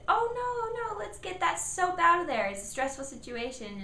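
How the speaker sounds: put-on calm the child down gently; reassuring parent. Shown as a demonstration. Parent soothing child with soap in eyes. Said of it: "You could".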